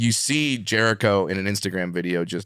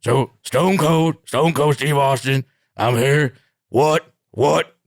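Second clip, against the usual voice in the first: pitched-up, louder British accent, slow at the start; higher, louder British twang on Stone Cold lines; 5 semitones higher, 4 dB louder, slower than usual. Shown as a demonstration. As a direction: demo Stone Cold's taunt as a Brit. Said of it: "if you're trying to do an impression, here's a cheat".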